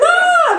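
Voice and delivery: very high shrieked ah; mock girls' scream. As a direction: shriek like a startled schoolgirl. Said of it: "They're all screaming and shit".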